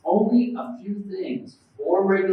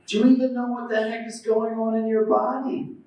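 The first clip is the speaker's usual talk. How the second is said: usual speaking voice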